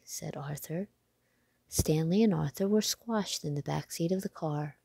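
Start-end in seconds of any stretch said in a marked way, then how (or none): none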